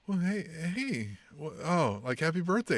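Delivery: soft, halting mock-shy greeting; hesitant, hushed hello, imitating tongue-tied exes; awkward longing. Two estranged friends bump into each other, awkward longing. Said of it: "that longing bromance sexual tension".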